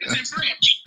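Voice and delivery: breathy, toneless whisper; It's in French, whispered under the breath; whispered aside. Acted. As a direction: whisper it to yourself. Repeats it, paper in French.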